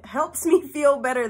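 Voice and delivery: a real laugh breaks into the phrase. Real, not acted. Admitting talking to her dead nephew is silly, laughing.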